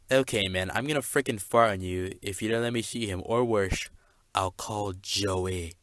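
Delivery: usual speaking voice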